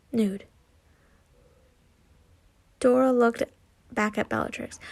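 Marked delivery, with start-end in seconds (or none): none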